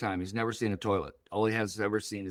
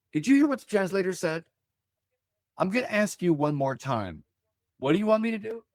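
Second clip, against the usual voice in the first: desperate prayer; 7 semitones higher than usual. Retold or imitated. Retold prayer to God, pleading.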